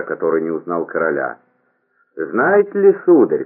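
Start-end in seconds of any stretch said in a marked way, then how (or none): 2.2-3.5 high, chanting stage peasant; singsong peasant impression. A narrator's reading. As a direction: imitate an actor playing a peasant, drawn out and high. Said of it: "произнёс он нараспев, подражая актёру". (нараспев, как актёр в роли крестьянина)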